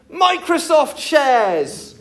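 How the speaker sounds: high, drawn-out holler; shouted wish. Acted. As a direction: yell it out while sliding down. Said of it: "she shouted".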